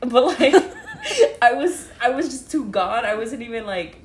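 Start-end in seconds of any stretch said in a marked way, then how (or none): 0.6-1.4 sudden squealing laugh, very high; real high-pitched laugh; burst of laughter. Real, not acted. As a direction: crack up mid-story. Laughing at her own story.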